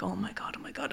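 breathed, barely voiced panic; terrified near-whisper. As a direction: mutter it under your breath in fear. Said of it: "I'm like".

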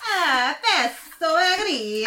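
high, drawn-out Italian parody; slow singsong cartoon Italian; mock Italian accent. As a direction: wail it like a hammed-up Italian. Joins mock Italian accent game.

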